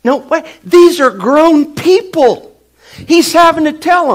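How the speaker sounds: loud, high preaching burst; full-force preaching. Real, not acted. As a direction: push it out fast and high.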